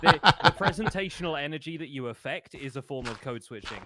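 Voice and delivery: words spilling out mid-laugh; laughing through words. Real, not acted. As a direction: talk through a real laugh. Laughing while explaining stream voice.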